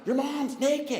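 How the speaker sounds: put-on high, loud kid's disbelief; mock shock. Johnny telling his friend about naked mom, mock shocked. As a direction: imitate a scandalized young friend. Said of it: "And Johnny's like".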